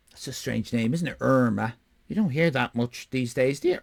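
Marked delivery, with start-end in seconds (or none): none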